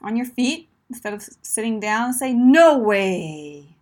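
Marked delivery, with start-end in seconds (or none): 2.3-3.8 loud, drawn-out, energetic "No way"; pumped up. Shown as a demonstration. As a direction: exclaim "No way" with full energy. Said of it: "put that energy up".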